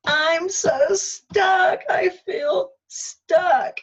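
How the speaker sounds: slow, high, loud crying wail; anguished wail. Retold or imitated. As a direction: cry it out in despair. Wailing magic phrase.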